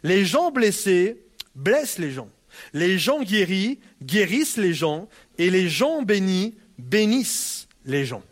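slow, weighted, each "gens" leaned on; slow emphatic maxim. Shown as a demonstration. Pastor quoting his teacher's maxim to the church, slow and weighted. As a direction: deliver the saying slowly, word by word. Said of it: "mon professeur ... nous disait ça".

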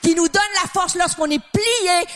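high, building preacher's fervour; rising preaching. Acted. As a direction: lift the congregation with rising conviction. Preaching climax at full force.

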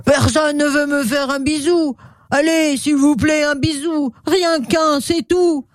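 high, pleading crocodile voice; big hoarse voice. Acted. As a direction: beg in a put-on rough crocodile voice. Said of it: "Une grosse voix rauque retentit". (grosse voix rauque et suppliante, plutôt aiguë)

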